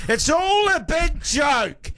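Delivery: high, slow, mock-outraged shout; slow, drawn-out outraged shout; shouted rant. Real, not acted. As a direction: bellow it out in slow disbelief. Ranting about rewritten history.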